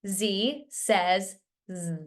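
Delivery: first Z named zee, last Z buzzed zzz; slow, spaced Z says Z, ending in a buzz; name the first Z, buzz the last Z; letter and sound. Shown as a demonstration. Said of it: "practice saying the letter and the sound".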